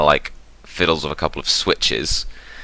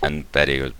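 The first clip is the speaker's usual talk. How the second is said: usual speaking voice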